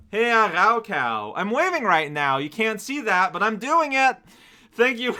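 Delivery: high, loud letter-reading voice; reading letter aloud. Acted. Streamer reads viewer's greeting letter, high, loud read-aloud.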